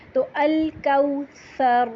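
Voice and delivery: الکوثر with tongue tip out for a soft th, no hiss, slow; tongue between the teeth on the ث of الکوثر; soft tha. Shown as a demonstration. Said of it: "زبان کی نوک کو باہر نکال کے". (الکوثر میں نرم ثا)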